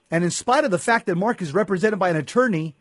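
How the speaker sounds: normal talking voice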